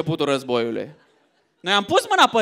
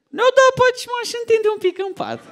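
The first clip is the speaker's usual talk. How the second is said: sleepy man; 13 semitones higher than usual. Acted. Sleepy man after sex.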